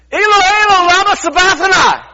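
high, loud anguished cry to God. Acted. Jesus on the cross cries out to God, high and loud. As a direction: cry it out across the hill in anguish. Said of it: "Jesus cried out in a loud voice".

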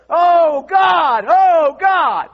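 high, loud repeated cries of "Oh, God!"; panicked cry. Retold or imitated. A preacher relives his car crash, shouting loud and high to God. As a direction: cry out as the car roof caves in.

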